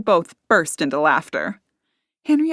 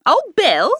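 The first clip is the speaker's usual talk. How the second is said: higher, louder surprised query; surprised question; 10 semitones higher, 5 dB louder than usual. A narrator's reading. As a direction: ask it with a start. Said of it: "the woman asked".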